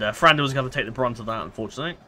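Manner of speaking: ordinary talk, nothing marked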